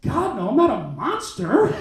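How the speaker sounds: drawn-out hammed-up protest; mock-offended denial. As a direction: protest as if insulted.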